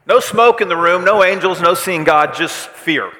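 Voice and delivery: loud, high outburst, coming down at the end; emphatic shout. Acted. Preacher recalling sheer earthquake fear, emphatic shout. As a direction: fire off the list at full volume.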